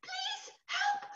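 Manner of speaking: very high tiny cry for help; a tiny creature trapped in the hedge calls out, very high; little voice. Acted. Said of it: "she heard a little voice saying".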